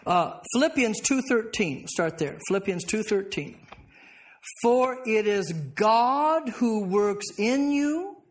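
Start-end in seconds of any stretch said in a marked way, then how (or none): 4.6-8.2 very slow reading. Shown as a demonstration.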